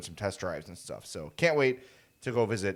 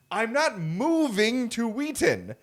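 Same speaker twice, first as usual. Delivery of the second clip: loud, high, exasperated rant; protest, half-laughing and fed up; 8 semitones higher, 5 dB louder than usual. Retold or imitated. Exasperated retold reply.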